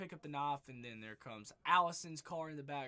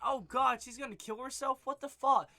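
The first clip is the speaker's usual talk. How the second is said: loud, high mock panic; shocked outburst; 8 semitones higher, 8 dB louder than usual. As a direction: blurt it out like the characters in the film. Characters react to the phone call.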